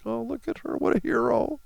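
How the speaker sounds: high-pitched mock admiration; mocking gushing friend. Mocking a Facebook friend's praise, high fake gushing. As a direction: gush in a put-on high voice. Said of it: "friend on Facebook is like".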